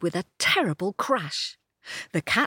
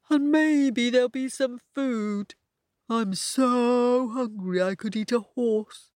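lightly voiced hungry donkey; a hint of a donkey, a bit higher; 5 semitones higher than usual. A narrator's reading. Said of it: "says Donkey".

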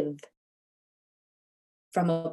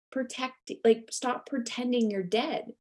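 ordinary talk

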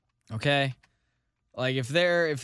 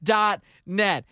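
mock ad read; 4 semitones higher than usual. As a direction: sell it like a cheesy radio ad.

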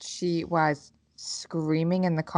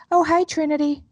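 high, louder sing-out hello, mimicking a child; chirpy mock-innocent greeting; sudden sweet greeting; 10 semitones higher, 5 dB louder than usual. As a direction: imitate a kid turning instantly friendly. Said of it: "just as fucking right as rain".

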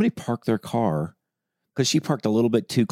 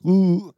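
high mock ooh; 11 semitones higher, 4 dB louder than usual. Mock reaction in story.

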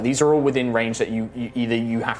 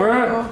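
high straining growl; 9 semitones higher than usual. Acted. Arvel struggles.

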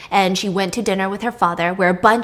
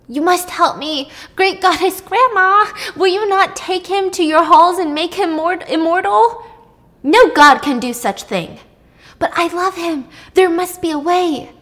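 put-on higher, earnest begging girl; pleading young nymph; 7 semitones higher, 3 dB louder than usual. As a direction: beg the goddess with all your heart. Circe's pleading voice.

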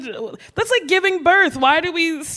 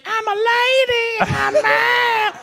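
high-pitched voice; 7 semitones higher, slower than usual. Retold or imitated. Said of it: "Was it, like, high pitched".

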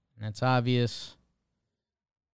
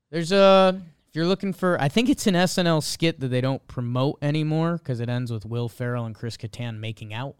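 ordinary delivery

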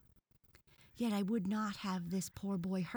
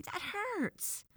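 very high, pained whine; boy in pain. A narrator's reading. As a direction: wail it way up high like a hurt little boy. Boy's hair is being pulled.

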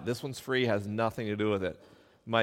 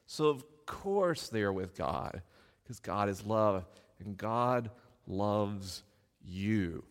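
tender parent; 3 semitones lower, slower than usual. Retold or imitated. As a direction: coo it gently to a toddler. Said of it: "My approach was like".

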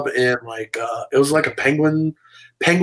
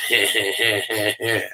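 smug little laugh at him; cocky laugh; 4 semitones lower, 5 dB quieter than usual. Retold or imitated. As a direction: laugh it off with a grin. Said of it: "a smile and just went".

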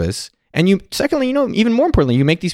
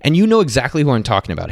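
ordinary talk, nothing marked

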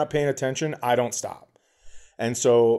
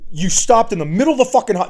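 fast, loud, high-pitched rant; losing it; 9 semitones higher, 8 dB louder, faster than usual. Retold or imitated. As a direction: blow up at him in a rush. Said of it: "now I'm losing my shit".

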